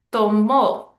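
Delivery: TOMÓ with the final -mó punched loud; last-syllable stress. Shown as a demonstration. Teacher showing a rule-breaker, Tomó stressed on the last syllable. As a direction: hit the end of Tomó, to-MÓ. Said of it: "second to last syllable is stressed. But look at this one".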